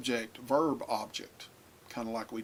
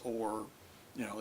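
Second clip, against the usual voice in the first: usual speaking voice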